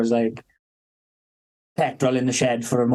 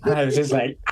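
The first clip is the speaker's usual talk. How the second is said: usual speaking voice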